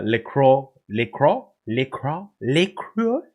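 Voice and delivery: trying "Lacroix" as /lɪkɹɔ/ several ways, ending on a questioning rise /lɪkɹu/; unsure pronunciation. Real, not acted. Streamer unsure how to say the player's name, trying versions. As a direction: fumble over "Lacroix", testing it. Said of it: "I don't know how the fuck to say it".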